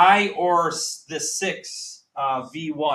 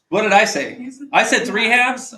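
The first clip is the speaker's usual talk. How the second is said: higher, louder, caught by own mistake; alarmed disbelief; 5 semitones higher, 5 dB louder than usual. Real, not acted. Teacher catching his own wrong answer, alarmed and louder. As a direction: gasp at the slip, voice jumping up. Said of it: "I'm freaking out here".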